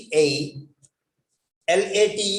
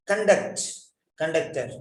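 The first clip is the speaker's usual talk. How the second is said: ordinary talk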